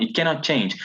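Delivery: usual speaking voice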